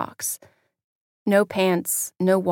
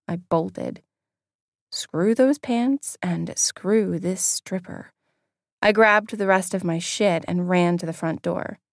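ordinary delivery

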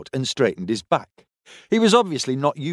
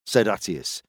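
usual speaking voice

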